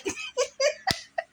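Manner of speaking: laughter up in a squeal; high squealing laugh. Real, not acted.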